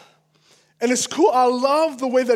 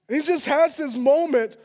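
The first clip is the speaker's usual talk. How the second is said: ordinary talk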